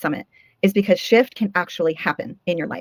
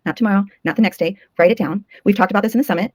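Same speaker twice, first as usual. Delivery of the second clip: normal talking voice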